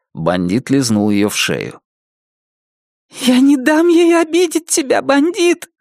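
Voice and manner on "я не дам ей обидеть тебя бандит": high, thin, protective; Nettie to her dog. Acted. Nettie comforting her dog Bandit, high protective voice. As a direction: promise the dog she'll protect him. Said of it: "сказала она".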